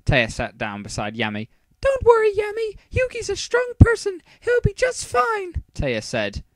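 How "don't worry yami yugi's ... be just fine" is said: very high falsetto reassurance; put-on girl's voice. Taya to Yami beside her, high falsetto comforting. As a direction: reassure him in a squeaky-high female falsetto. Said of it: "even the Taya one's a bit debatable".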